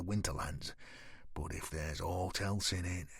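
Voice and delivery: low, soft, steady warning; a hushed edge of threat; quiet voice. Acted. Knight quietly warning the boy he may leave. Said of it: "John went on in that quiet voice".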